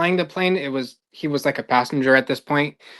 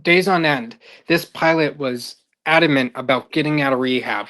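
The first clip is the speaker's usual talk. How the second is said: ordinary delivery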